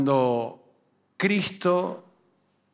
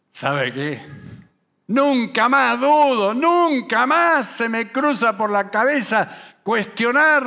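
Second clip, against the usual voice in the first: boastful believer; 4 semitones higher, 4 dB louder than usual. Retold or imitated. Preacher mimics himself swearing never to doubt, rising. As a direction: build from a mumble to an emphatic vow. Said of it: "yo digo".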